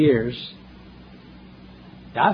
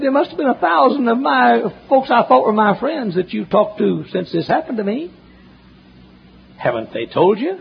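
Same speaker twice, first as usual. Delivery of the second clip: a lightly voiced, much higher pleading preacher; upset caller; 11 semitones higher, 3 dB louder than usual. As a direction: act the distraught caller, pitched up and louder.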